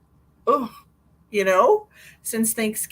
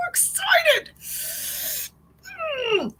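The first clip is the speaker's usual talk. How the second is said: overjoyed squeal; much slower than usual. Real, not acted. Gushing about kids visiting.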